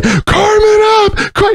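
high wailing cry; crying scream. Retold or imitated. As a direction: wail through tears. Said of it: "And he starts crying".

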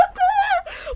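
squeaky character laugh; high giggle. Acted.

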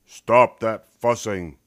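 dad ordering the cubs to stop; loudest Papa Bear voice. A narrator's reading. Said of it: "in his loudest Papa Bear voice".

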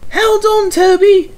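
very high loud engine call; tooting call. A narrator's reading. Mavis calling to Toby, high and loud. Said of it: "she tooted".

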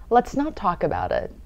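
normal talking voice

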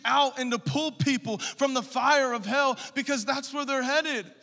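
loud, high preaching at full force; fiery preaching. Acted. Pastor urging the congregation, loud fiery preaching. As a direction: preach it hard to the church.

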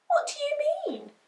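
high, quick puppy voice; surprised little pup; puzzled puppy. A narrator's reading. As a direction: ask in high, breathless wonder.

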